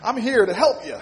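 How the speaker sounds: hammed-up movie cowboy imitation; John Wayne. Young law student introducing himself to tenant president, cowboy imitation. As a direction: put on a swaggering cowboy voice for comic effect. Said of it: "in my best John Wayne imitation".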